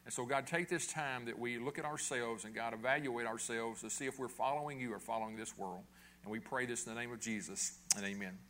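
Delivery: quiet, hushed closing prayer. Real, not acted. Pastor praying over the congregation, hushed. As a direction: pray it softly and reverently.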